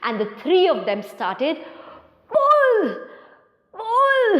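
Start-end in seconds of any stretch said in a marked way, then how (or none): 2.3-4.4 high straining chant of pull; effortful pull. Acted. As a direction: heave with each word. Characters straining at turnip.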